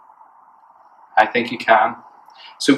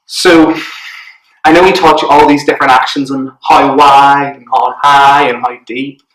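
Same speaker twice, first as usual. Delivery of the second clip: normal talking voice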